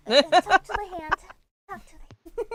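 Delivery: sassy. Acted. Sassy playful retort.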